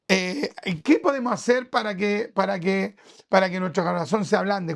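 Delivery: ordinary talk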